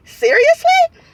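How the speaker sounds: very high, incredulous retort; exasperated disbelief. Retold or imitated. Patient unable to have pain drugs, reacting in disbelief. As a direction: squeal it as a disbelieving question.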